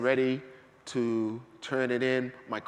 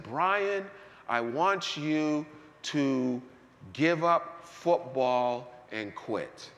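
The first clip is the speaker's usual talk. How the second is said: slow voice of the Lord; much slower than usual. Retold or imitated. The Lord speaking to preacher.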